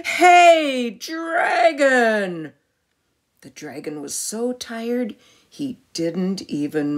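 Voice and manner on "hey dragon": high, loud yell into the ear; shouting as loud as she can. Acted. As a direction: yell to wake a sleeping dragon. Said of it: "shouted as loud as she could".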